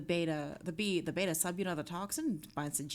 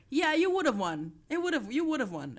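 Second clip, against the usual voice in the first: excited; 9 semitones higher, 9 dB louder than usual. Real, not acted. Excited reaction to game win.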